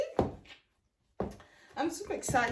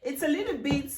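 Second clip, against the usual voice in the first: much higher, delighted squeal over the shoes; excited squeal at new boots; thrilled gush; 9 semitones higher than usual. Real, not acted. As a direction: gush with real delight, pitch flying up.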